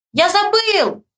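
high-pitched holler back; high calling voice; shouted reply. A narrator's reading. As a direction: yell it across the yard. Boys shouting across yard.

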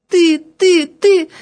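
put-on high child voice on the edge of tears; small, high, stubborn girl repeating after teacher; babbling little girl. A narrator's reading. Girl repeats after angry teacher, tearful babble. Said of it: "пролепетала она, едва разжимая губы".

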